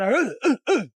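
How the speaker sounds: sudden loud, high grunts; loud mock struggle noises; imitated grunts of a struggle. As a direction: grunt like someone getting jumped. Imitates father being jumped behind him.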